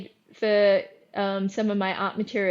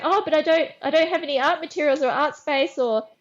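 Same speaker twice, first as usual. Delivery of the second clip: flustered; 7 semitones higher, 3 dB louder than usual. Retold or imitated. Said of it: "I was like".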